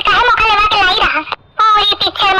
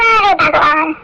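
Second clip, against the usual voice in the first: ordinary talk, nothing marked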